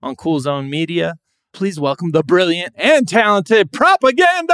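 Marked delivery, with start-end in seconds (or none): 1.5-4.5 hyped guest introduction. Acted. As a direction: announce the guest like a ring announcer.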